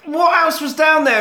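high-pitched, hammed-up eagerness; acted eager question. Villain asking diver what was down there, acted eagerness. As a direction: play an agog partner, pitched right up.

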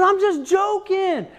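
high mock protest; imitated pleading mom. Wife defending her joke to crying son, high protest. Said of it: "she says".

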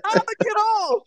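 high-pitched mock dismay; squeaky-high worried question; dismayed girl. Voicing stranded girl in story.

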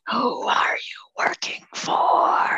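raspy, slow undead whisper; lich voice. Acted. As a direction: rasp it out like an ancient lich. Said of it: "I'm using my lich voice".

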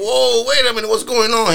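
high-pitched retold alarm; startled protest. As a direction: jump up in pitch, acting taken aback. Retelling own startled reaction to insult.

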